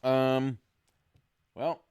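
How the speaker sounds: normal talking voice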